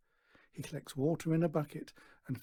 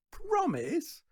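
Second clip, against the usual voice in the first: very high, mocking rising question; scornful snort; 4 dB louder than usual. A narrator's reading. Daughter mocking Stan's word, high scoff. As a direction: sneer the word back at him. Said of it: "She snorts again".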